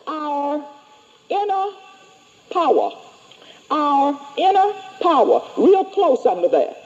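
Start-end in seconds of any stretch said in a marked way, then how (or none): 0.1-5.4 spaced out for writing down; dictating. Shown as a demonstration. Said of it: "write under superconscious".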